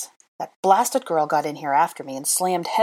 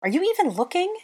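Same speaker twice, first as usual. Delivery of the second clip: high-pitched accusing question; indignant; 13 semitones higher, faster than usual. A narrator's reading. Hermione accusing Draco mid-quarrel, indignant. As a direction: demand it with voice shooting up.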